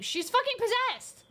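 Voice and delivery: high, sudden alarmed cry; a high, panicked shriek that she is possessed; panicked outburst. Real, not acted.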